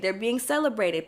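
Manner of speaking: usual speaking voice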